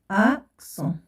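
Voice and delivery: "أكسون" split into ak and son with a pause. Shown as a demonstration. Teacher dictates syllables while writing.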